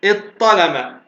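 إذ ظلم repeated as iṭ-ṭalama, no ẓ heard; doubled ṭa. Shown as a demonstration. Teacher repeats the second option, doubled ṭ in إذ ظلم. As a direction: merge إذ ظلم into a held ṭ. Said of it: "بطاء مشددة".

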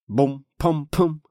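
loud, high, drawn-out banging noises; imitated impacts. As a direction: voice the hits as heavy booms. Narrator imitates meteor impacts. (громкая имитация ударов)

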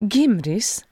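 sharp, high reprimand; snapping. A narrator's reading. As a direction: snap the name as a rebuke. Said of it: "Mizim snapped".

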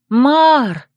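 sudden exclamation. A narrator's reading. Heroine suddenly remembers the lecturer, exclaiming. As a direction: exclaim the name as it dawns on you. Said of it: "воскликнула я".

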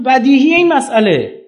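high, loud and drawn out; emphatic retold answer. Retelling his emphatic answer at lecture.

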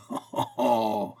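acted laugh after mock wailing; short falling laugh. A narrator's reading. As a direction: break into derisive laughter. End of mock lament.

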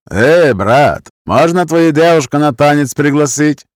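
put-on cocky Roma accent, leaning in to ask. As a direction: Roma accent, swaggering drunk. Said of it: "голос с цыганским акцентом".

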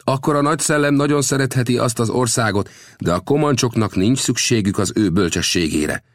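high-pitched, proud chief's voice; dismissive chief. Acted. Chief rejects the visitor's wisdom, proud and high.

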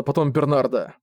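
ordinary delivery

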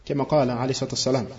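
normal talking voice

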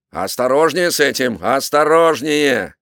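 loud order, last word drawn out; shouted warning. A narrator's reading. Captain warns sailors hauling a powder barrel, shouting. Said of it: "крикнул он матросам".

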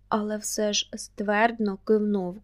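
usual speaking voice

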